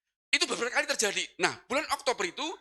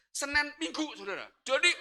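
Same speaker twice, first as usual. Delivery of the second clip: ordinary delivery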